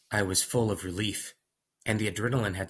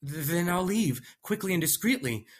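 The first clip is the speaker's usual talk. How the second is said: nervous stammer; 10 semitones higher than usual. Acted.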